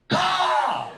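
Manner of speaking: high, loud crow caw. Retold or imitated. Crow on a branch cawing at him, high and loud. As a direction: squawk it like a crow.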